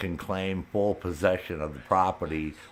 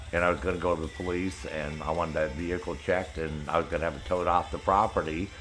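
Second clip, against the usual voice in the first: ordinary talk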